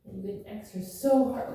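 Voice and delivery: ordinary talk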